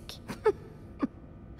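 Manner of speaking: short, high, airy chuckle; amused chuckle. Acted. Telling a coworker about graffiti on Linda's door, amused chuckle. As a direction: chuckle lightly, mostly on breath.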